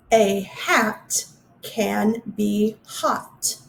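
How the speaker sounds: very slow, every word spaced apart; slow careful reading. Shown as a demonstration. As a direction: read the last sentence slowly. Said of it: "the last one".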